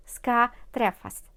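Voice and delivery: ordinary delivery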